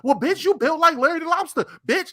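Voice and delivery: imitating a high-pitched tirade; mock insult tirade. Retelling what was heard on joining the space, mock high tirade.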